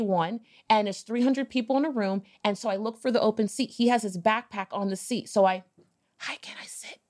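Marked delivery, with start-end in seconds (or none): 6.2-7.0 hushed whisper to a stranger. Retold or imitated. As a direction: whisper it so the room can't hear. Sneaking into a seat late.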